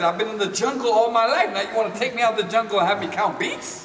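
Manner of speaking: high and loud mock outrage; indignant protest. Dancer protesting to his teacher June, loud and indignant. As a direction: play up the street kid being dragged out of the jungle.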